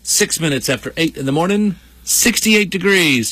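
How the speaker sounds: big morning-radio welcome, pitched high; radio show opener. Acted. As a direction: sing out the show's opening like a radio host.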